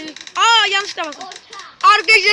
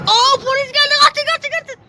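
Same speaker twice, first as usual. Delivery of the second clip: mock alarm; 6 semitones higher than usual. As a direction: fake panic, pitched up. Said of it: "Şaka, şaka".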